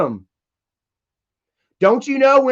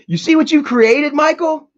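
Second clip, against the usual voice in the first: mocking taunt; 6 semitones higher, 3 dB louder than usual. Real, not acted. Taunting Michael.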